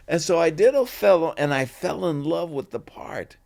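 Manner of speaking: ordinary talk, nothing marked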